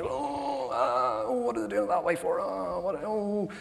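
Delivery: impatient grumble. Retold or imitated. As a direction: huff at how someone else acts. Impatient with someone at church.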